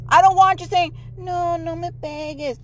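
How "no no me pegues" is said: soft, slow mock-timid voice; meek plea. Mom mocking what she doesn't want said, meek soft plea. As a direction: plead weakly, barely speaking up. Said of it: "No, you speak up".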